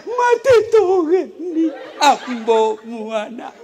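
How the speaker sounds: high, loud hammed-up wailing lament; mock wailing widow. Preacher mimics a widow wailing over her husband, mock sobbing lament. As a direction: wail like a grieving widow, half laughing. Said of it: "Ada orang meninggal suaminya".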